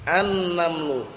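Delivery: "An-namlu" with a long n and no l; silent lam. Shown as a demonstration. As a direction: lean on the doubled n in "An-namlu". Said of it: "tapi an-namlu".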